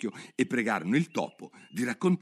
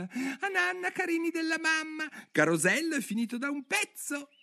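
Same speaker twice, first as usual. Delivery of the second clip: much higher tiny canary voice; the canary mother calls her little ones in a tiny, much higher voice; tremulous little voice. Acted. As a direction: coo to the chicks in a tiny tremulous voice. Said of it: "dicendo con una vocina tremula".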